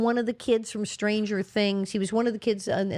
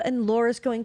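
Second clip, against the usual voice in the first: ordinary delivery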